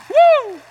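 high, loud shouted woo; joyful whoop. Real, not acted. Preacher whoops after quoting Jesus on gifts, loud and high. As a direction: let out a jubilant whoop.

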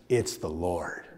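ordinary delivery

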